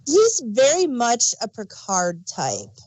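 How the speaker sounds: ordinary talk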